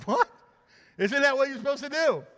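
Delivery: mock ticked-off. Missionary ticked about revival.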